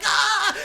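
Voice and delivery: very high startled yelp; a shrill cartoon panic cry; panicked yelp. Dad struggling with the computer, very high yelp.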